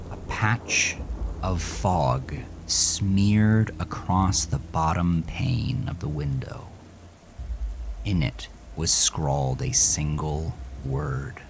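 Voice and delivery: usual speaking voice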